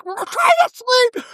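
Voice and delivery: very high, stretched-out mock shout; mock yelling. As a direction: imitate a furious holler, slow and squealed. Imitating furious father's yell.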